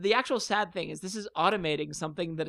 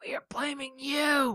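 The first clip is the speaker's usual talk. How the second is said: shouted whisper; 8 semitones higher, slower than usual. Acted. Mock threat over song ban.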